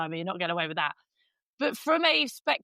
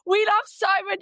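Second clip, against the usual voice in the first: fans gushing; 9 semitones higher, 5 dB louder than usual. Retold or imitated. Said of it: "People have messaged on Instagram going".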